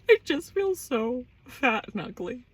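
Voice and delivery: high, whiny self-pity; mock whine. Woman moping to her partner, high mock whine. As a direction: whimper it in exaggerated misery.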